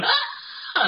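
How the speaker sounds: short high-pitched "Ah!"; playful child's squeal. Acted. Therapist voicing child parts at play, gleeful squeal. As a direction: squeal out like a kid let loose.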